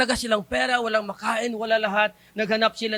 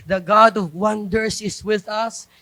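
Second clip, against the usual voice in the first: ordinary talk, nothing marked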